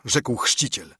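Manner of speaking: ordinary delivery, nothing marked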